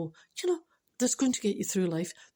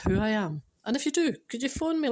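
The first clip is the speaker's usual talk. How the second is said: ordinary delivery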